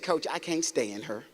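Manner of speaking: low, soft, slow quoted reply; low and slow, owning up to the coach; player's sullen answer. Retold or imitated. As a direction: mimic a player's quiet confession. Player's low grudging answer retold.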